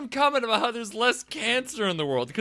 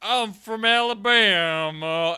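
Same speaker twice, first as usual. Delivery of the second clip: loud, slow, mock Alabama drawl; bad Alabama accent; 5 dB louder, slower than usual. Mocking the actors' terrible accents, exaggerated Alabama accent. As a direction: overdo a Southern Alabama accent, stretching the vowels. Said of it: "Like, is the accent just".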